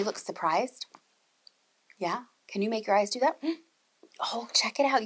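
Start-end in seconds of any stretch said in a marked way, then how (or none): 4.2-5.0 whisper. Real, not acted. Teacher praises the children's faces, in a whisper. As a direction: lean in and whisper it.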